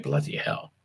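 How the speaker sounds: Bloody hell breathed under the breath; whispered curse. Real, not acted. Host reacting alone to a long episode list, whispered curse. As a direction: mutter the curse almost silently.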